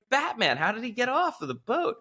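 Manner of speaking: high, astonished question; mock amazement. Crypto guy marvels at the vanished president, high and amazed. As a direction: ask it high as if witnessing magic. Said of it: "he's like".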